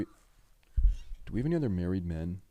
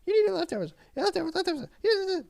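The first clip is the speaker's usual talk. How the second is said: very high squeaky mock wife voice; mock the wife's nagging in a shrill falsetto; imitating wife; much faster than usual. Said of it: "But every day".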